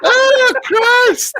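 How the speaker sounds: long high-pitched real laughing fit; helpless laughter. Real, not acted. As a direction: crack up, then cry out through it. Helpless laughter at his own bit.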